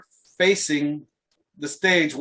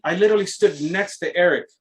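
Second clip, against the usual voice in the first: usual speaking voice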